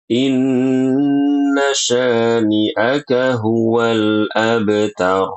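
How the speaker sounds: chanted slowly, nun of Inna held two beats; slow Quran recitation. Shown as a demonstration. Teacher reciting the verse as model. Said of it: "nunnya harus ditahan dua harokat".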